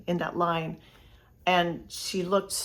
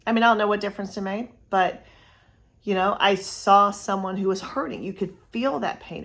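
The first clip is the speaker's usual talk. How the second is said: normal talking voice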